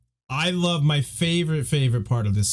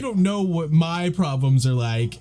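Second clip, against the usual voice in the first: higher-pitched mock teen complaint; whiny teenager; 7 semitones higher than usual. Teen Jon griping about his problems to Aemon, whiny. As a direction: play up a teenager's self-pitying whine. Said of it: "such a typical whiny teenager".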